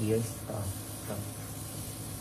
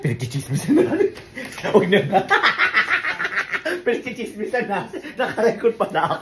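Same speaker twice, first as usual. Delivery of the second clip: laughing; 8 semitones higher than usual. Real, not acted. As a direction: laugh while scolding yourself. Laughing at gossiping.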